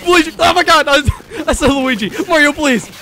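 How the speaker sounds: high, loud panicked outburst; panicked yelling. Real, not acted. Player caught by Mario, loud panicked yelling. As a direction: blurt it out in real panic.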